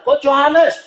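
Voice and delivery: man running up calling the coach by name, mock shout. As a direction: imitate a man calling across the hall. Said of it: "teriak kenceng banget".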